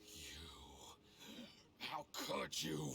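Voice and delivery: faint breathless word, then a strained outburst; gasping betrayal. Acted. Poisoned man to his betrayer, gasping reproach. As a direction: force out an accusation through failing breath.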